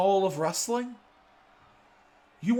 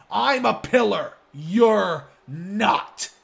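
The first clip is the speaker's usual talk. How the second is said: louder, higher defiant claim; loud boast; 3 semitones higher, 7 dB louder, slower than usual. Real, not acted. [name] boasting to Ricky that he is the pillar, loud and defiant. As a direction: throw it right in his face.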